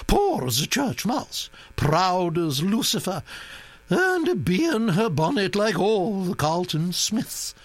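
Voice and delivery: high, brisk gossiping dowager voice; imperious old Duchess. Acted. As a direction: play a bossy old aristocrat full of opinions. Duchess gossiping about her cousin.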